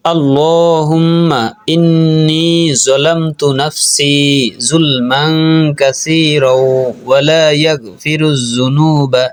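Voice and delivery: slow, measured chant with long held vowels; unhurried melodic teaching recitation; Arabic prayer recitation. Shown as a demonstration. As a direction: recite slowly, drawing out each long syllable.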